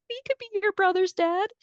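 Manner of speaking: put-on high, sweet kid voice; imitated little sons. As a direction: play small boys offering comfort to their dad. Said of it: "and then the two little sons".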